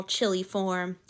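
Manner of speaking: ordinary delivery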